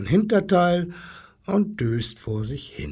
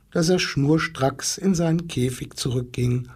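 plain reading voice

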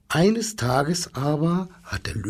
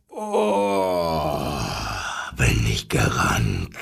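much lower, sighing tired lion; 12 semitones lower, much slower than usual. Acted. Lion wakes tired from his dream, deep sigh. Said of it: "seufzte der goldene Löwe".

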